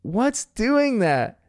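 slow, high, beaming question; smiling kid voice. Retold or imitated. Bingo delighted by her flying treasure, smiling high voice. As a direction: ask it through a huge grin. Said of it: "through a massive smile".

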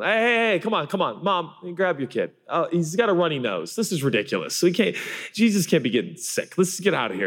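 high, hurried voice of fussy bouncers; mock-officious crowd control; disciples shooing parents. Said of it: "Here's the disciples going".

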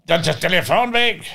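mock official snapping at him; detective announcing it; police officer. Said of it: "einer von den Kripo-Beamten war".

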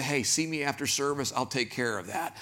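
hushed and low, a private aside; soft, secretive invitation; imagined whisper. Retold or imitated. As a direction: say it quietly, as if after service. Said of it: "could have come over and whispered".

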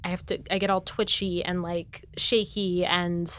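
ordinary talk, nothing marked

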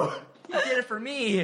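excited. Retold or imitated. Reliving cheering outburst.

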